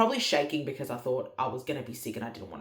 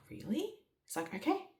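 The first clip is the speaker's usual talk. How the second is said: woken husband; 10 semitones higher, 8 dB quieter than usual. Retold or imitated. Voices husband just woken.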